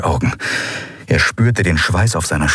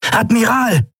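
high urgent call; officer alerting the Admiral under fire, calling out. Acted. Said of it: "rief Quailor".

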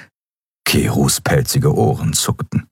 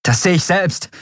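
calling out; 12 semitones higher than usual. A narrator's reading. Pilot to crewmate reporting pursuit, calling out. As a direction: call it back in a raised pitch. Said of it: "rief Endriel".

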